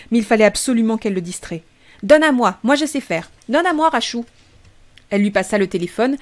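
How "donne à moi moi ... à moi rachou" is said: put-on high, insistent small-child voice; eager little boy. Blake insists to his sister he can work the phone, high and eager. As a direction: demand it like a toddler who knows best.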